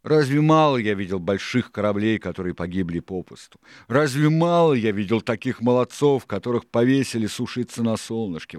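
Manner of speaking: exclaiming. A narrator's reading. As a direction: cry out the questions with rising force. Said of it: "воскликнул Сильвер".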